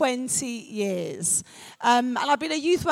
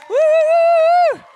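loud, high sustained woo; cheering whoop; 11 dB louder than usual. Real, not acted. Host thanks the boy who prayed, excited whoop. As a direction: let out a big whoop.

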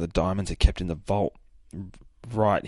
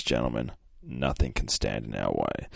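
low villain voice; 9 semitones lower, 6 dB quieter than usual. Acted. Villain's line read in comic.

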